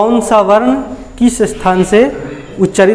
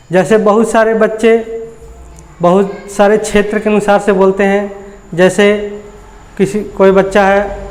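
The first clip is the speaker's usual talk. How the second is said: ordinary talk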